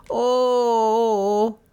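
long, high wail of doom; slow wail. A narrator's reading. Lizzie despairs in the dark, wailing slowly. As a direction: wail one long drawn-out Oh. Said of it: "Lizzie began a slow wail of doom and disaster".

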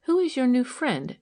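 ordinary delivery, nothing marked

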